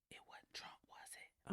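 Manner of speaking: hushed unvoiced whisper. Real, not acted. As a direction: whisper it under your breath. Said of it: "Why you whispering?".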